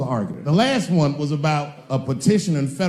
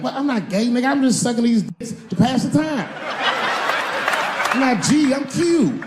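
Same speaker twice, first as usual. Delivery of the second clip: higher, defensive con voice; mock prison tough guy; 7 semitones higher than usual. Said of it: "prison f***ers are like".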